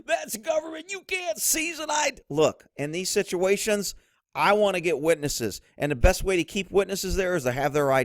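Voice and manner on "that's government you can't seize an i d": high, indignant mock shout; imitating an outraged constitutionalist, high-pitched yell; mock constitutionalist outrage. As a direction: squeal the protest like an outraged critic. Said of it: "I know the constitutionalists will be here".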